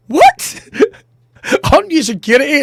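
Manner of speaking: very high, squeaked disbelief; baffled high-pitched query; incredulous. Acted. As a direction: squeal the first word in mock astonishment. Baffled at clueless security guard.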